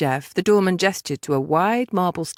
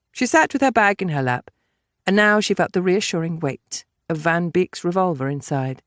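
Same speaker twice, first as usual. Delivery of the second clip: ordinary delivery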